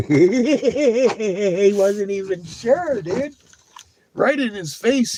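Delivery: excited squeal. Real, not acted. Laughing excited telling about puppy.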